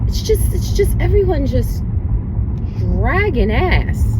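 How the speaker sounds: high, whiny complaint about traffic; fed-up groan. Real, not acted. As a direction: moan about the slow drivers. Stuck in slow traffic.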